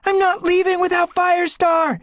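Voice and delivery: very high, loud desperate cry. Acted. Squirrelpaw refusing to leave her father, desperate cry. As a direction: refuse at the top of your voice. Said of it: "Squirrelpaw cried".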